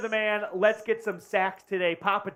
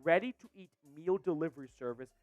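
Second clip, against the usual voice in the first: smooth, even ad-copy read; sponsor ad read; 3 semitones lower, 4 dB quieter than usual. Acted. Host reading the Factor Meals sponsor spot, ad read. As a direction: sell the meal service clearly.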